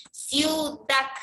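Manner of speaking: "Ciudad" split in two, weight on "dad"; teacher's deliberate "Ciudad", final syllable stressed. Shown as a demonstration. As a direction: sound out "Ciudad" slowly as ciu, then dad. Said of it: "la sílaba tónica está en dac".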